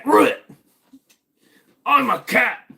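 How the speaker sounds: high, loud squeaky cat cry; silly cat voice. Acted. Streamer mimics a cat for chat, high squeaky yowl. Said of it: "That's what cats sound like".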